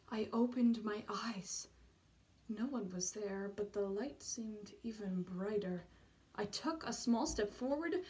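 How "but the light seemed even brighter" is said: usual speaking voice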